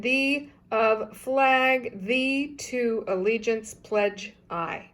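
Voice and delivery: slow, careful backwards reading; backwards speech. Shown as a demonstration. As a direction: read each reversed phrase slowly, word by word. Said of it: "So let's try that one more time".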